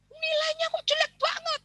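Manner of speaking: very high, shrill mock-outraged parent; over-the-top parent. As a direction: overreact to the grade. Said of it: "lebaynya itu nggak tahu".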